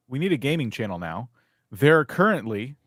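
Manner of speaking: ordinary talk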